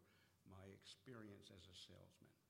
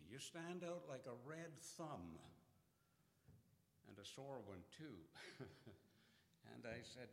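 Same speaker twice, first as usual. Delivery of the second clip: ordinary talk, nothing marked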